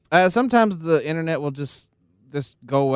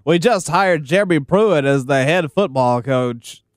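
sports radio announcer impression; Bob Kessling; 3 semitones lower than usual. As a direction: announce it big, drawing out the last word. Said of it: "This is Bob Kessling with the Vol Network".

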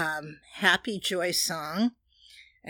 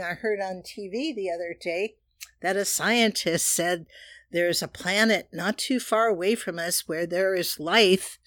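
normal talking voice

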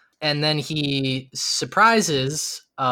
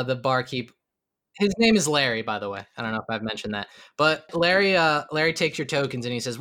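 ordinary delivery

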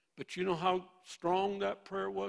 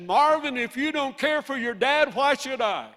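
booming, much higher and louder divine rebuke; voice of God; 8 semitones higher, 6 dB louder than usual. Retold or imitated. Preacher voicing God rebuking Marvin about his dad, booming. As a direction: thunder it down like God himself. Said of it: "the voice of God touched me so powerfully and said".

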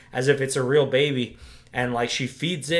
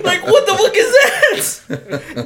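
drawn-out, high, loud mock freak-out; horrified shriek; 7 dB louder, much slower than usual. Viewer recoiling from the suckling thing, horrified shriek. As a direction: wail it in comic horror. Acts viewer's shock at nipple scene.

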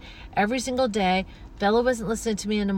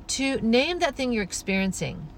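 ordinary delivery

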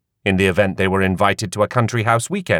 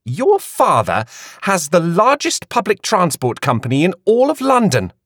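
put-on high, light young woman's voice; Queenie's voice; 9 semitones higher than usual. A narrator's reading. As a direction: Queenie pressing her friend, curious.

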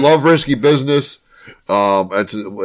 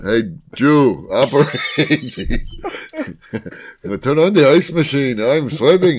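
put-on Yiddish accent; 3 semitones higher than usual. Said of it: "Where's this accent coming from?".

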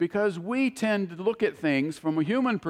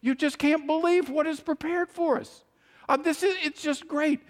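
imitating an awed witness, much higher pitch; overwhelmed wonder; 10 semitones higher than usual. Preacher quoting the man at a loss for superlatives, overwhelmed. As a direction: gush in a high voice, as if words fail. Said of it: "He just keeps repeating it, going".

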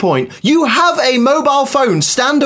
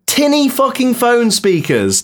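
usual speaking voice

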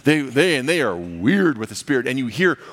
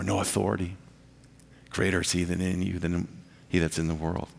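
low, soft, settled assurance; quiet reassurance; 6 semitones lower, 7 dB quieter than usual. Real, not acted. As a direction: reassure them gently, voice dropped down. Preacher reassures believers of their standing.

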